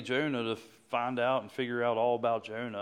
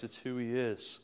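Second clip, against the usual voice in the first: ordinary talk, nothing marked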